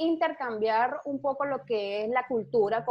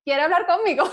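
playful chatty quote; 6 semitones higher, 6 dB louder than usual. Retold or imitated. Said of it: "yo soy de las personas que está en una cola y yo".

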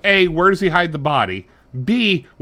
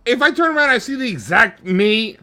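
usual speaking voice

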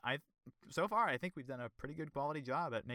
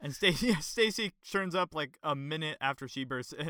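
ordinary talk, nothing marked

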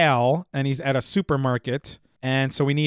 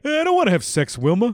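cartoon husband voice; 6 semitones higher than usual. Flintstones joke voice.

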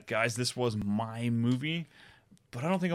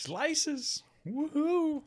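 excited; much slower than usual. Real, not acted. Celebrating a score of six.

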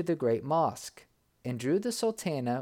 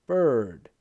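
a louder call; cried out; 3 semitones lower, 7 dB louder than usual. A narrator's reading. Sultan crying out to the bird as the truth dawns, louder. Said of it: "he cried".

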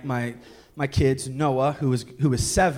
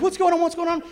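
high, rushed anxious wife; the wife panicking as they arrive home; frantic wife; 5 dB louder, faster than usual. Retold or imitated. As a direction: pregnant wife asking anxiously, pitched high.

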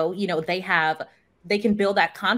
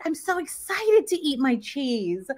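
put-on higher, slow, sing-song little boy; 5 semitones higher than usual. Toddler telling mom about his cheese, lightly voiced child. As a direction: play the toddler gleefully excited.

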